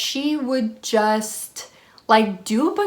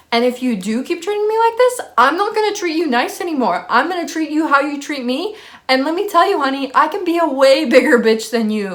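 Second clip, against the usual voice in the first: higher, heated re-enacted threat; retold angry warning; 6 semitones higher, 4 dB louder, faster than usual. Warning the roommate about treatment, in a heated retold voice. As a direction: lay down the law, pitched up and insistent.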